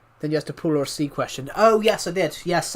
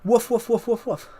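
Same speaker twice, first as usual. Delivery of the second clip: high-pitched spoken woofs; string of pretend dog woofs; mock barking; 6 semitones higher than usual. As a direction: bark playfully like a dog, pitched up. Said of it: "I'm barking".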